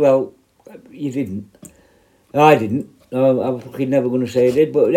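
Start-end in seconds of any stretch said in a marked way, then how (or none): 0.9-1.5 quick hushed whisper; whispered aside. Real, not acted. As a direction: drop to a whisper for a jab.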